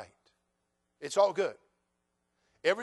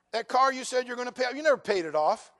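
ordinary delivery

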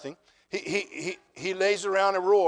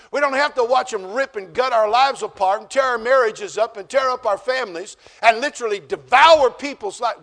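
louder and higher, hammering each phrase; full-force preaching; 4 semitones higher, 9 dB louder than usual. Acted. Pastor warns the church against the world, with fiery preaching. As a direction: thunder it at the congregation.

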